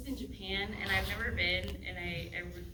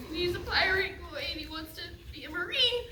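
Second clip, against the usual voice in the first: put-on high, loud boy pirate voice; Kobe; 10 semitones higher, 3 dB louder than usual. Shown as a demonstration. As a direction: pitch way up as an anime boy. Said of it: "he kinda sounds like this".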